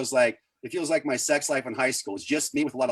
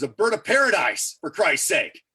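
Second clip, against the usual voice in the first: loud, higher-pitched exasperated retort; acted exasperation, pitched up; 5 semitones higher, 5 dB louder than usual. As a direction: blurt it out, fed up. Retold exasperated answer about tattoo.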